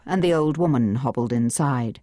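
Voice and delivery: plain reading voice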